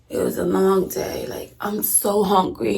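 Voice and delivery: slow, drained mock complaint; feigned exhaustion. Faking a day of fasting to grandmother, feigned exhaustion. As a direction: put on being faint with hunger, dragging the words. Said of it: "acting like I'm about to pass the hell out".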